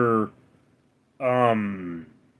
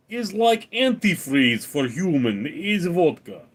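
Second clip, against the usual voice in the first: put-on broken-English Russian accent, pitched higher; mock Russian accent; 4 semitones higher than usual. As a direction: play a hammed-up Russian explaining vodka. Joking about Russia and vodka.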